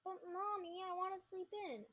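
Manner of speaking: lightly acted sleepy-kid complaint; sleepy child's protest. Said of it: "Hunter mumbled".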